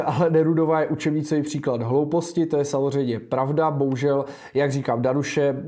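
ordinary talk

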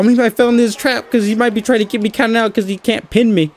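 normal talking voice

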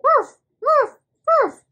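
high, loud playful puppy woofs; squeaky loud pretend barking; dog bark. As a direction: bark like an excited little dog.

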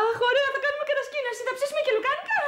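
put-on high, girlish-eager squeal of delight; gleeful excitement. Woman delighted about camping with the professor, gleeful. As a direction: bubble over, pitch climbing high.

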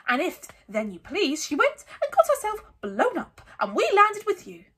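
high, loud spiteful tirade; Aunt Petunia ranting. A narrator's reading. Aunt resenting being landed with Harry, ranting. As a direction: build to a scornful high pitch. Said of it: "then went ranting on".

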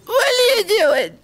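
very high, loud, drawn-out screech; mock-hysterical protest. Gamer at the end of the countdown, drawn-out hysterical screech. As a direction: screech it slowly, stretching the words.

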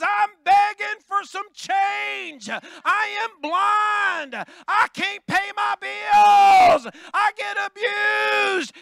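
high, loud, slow beggar's plea; desperate begging shout. Acted. Bartimaeus begging Jesus for change, a high slow shout. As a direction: shout the plea like your life depends on it. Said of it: "He says".

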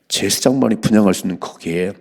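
ordinary talk

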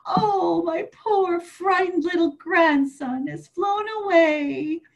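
high, mock-tearful lament; hammed-up grief for a lost grandson; grandma crying out in sorrow; wailing grandma.

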